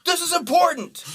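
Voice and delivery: high, loud outburst; hammed-up furious singer shouting; mock angry yell. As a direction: snap back at the heckler like an offended artist. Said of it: "the lead singer yells at him".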